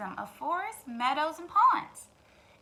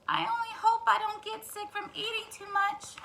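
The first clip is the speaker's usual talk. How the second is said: worried hen; 9 semitones higher than usual. Acted. Worried hen voiced in story.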